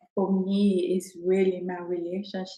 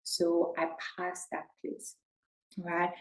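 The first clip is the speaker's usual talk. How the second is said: ordinary talk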